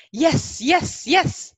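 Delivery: loud, high, hammed-up cheer; mock hype yourself up in a mirror, shouting; self pep talk. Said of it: "katakan pada diri Anda". (sorakan menyemangati diri yang dilebih-lebihkan)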